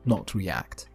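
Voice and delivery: ordinary delivery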